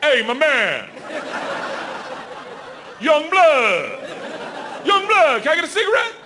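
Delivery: hammed-up high street voice; mock street hustler. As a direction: beg a smoke like a corner guy. Voicing the crotch as street guy.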